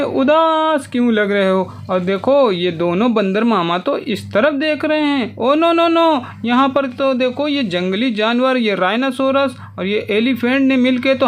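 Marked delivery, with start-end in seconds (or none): 5.4-6.2 high, louder "oh no" of playful dismay; mock dismay. Narrator finds the carrom stolen, high playful dismay. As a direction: gasp it out for the kids, higher.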